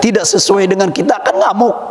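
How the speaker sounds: ordinary talk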